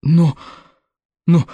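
stuttering, panicked buts; stammering in horror. A narrator's reading. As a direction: stumble over the words in fright. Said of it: "От ужаса ... начала заикаться".

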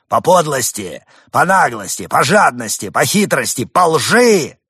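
high, loud accusing rant; ranting demagogue. Acted. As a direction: list the sins with fervor. Demagogue speech.

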